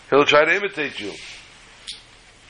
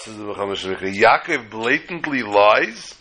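normal talking voice

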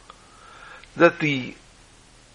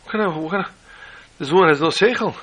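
alarmed bus riders; 6 semitones higher than usual. Acted. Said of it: "they're saying".

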